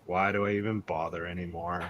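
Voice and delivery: low, resigned mumble to his feet; dejected goblin. Acted. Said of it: "looks at his feet and says".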